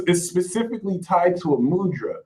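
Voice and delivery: normal talking voice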